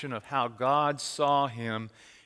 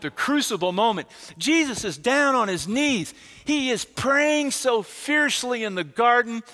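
loud, high, slow climactic sermon delivery; impassioned preaching; 8 semitones higher, 7 dB louder than usual. Acted. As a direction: pour everything into the garden prayer.

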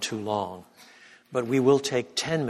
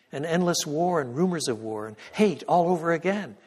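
impassioned preaching; 6 semitones higher than usual. Real, not acted. Preacher warning the congregation of endless war, impassioned. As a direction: preach it with mounting force.

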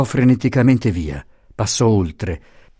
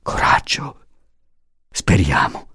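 hushed words of comfort; low, whispering reassurance; whispered; 4 semitones lower than usual. A narrator's reading. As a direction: whisper gentle encouragement. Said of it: "Gli altri bisbigliavano".

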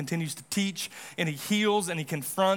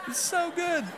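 real laugh breaking through, higher; laughing with delight; 9 semitones higher than usual. Real, not acted. Pastor laughs at an insight not in his notes, delighted. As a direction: giggle at your own discovery.

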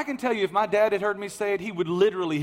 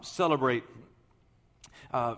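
ordinary talk, nothing marked

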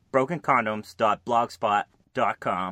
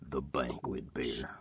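Sam Elliott voice; 13 semitones lower, 13 dB quieter than usual. Retold or imitated.

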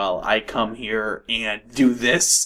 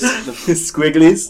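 laughing; 3 semitones higher, 4 dB louder, much slower than usual. Real, not acted. Laughing repeat of squigglies.

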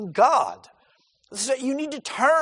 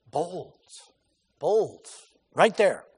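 ordinary talk, nothing marked

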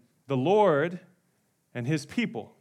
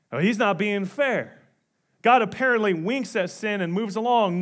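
ordinary talk

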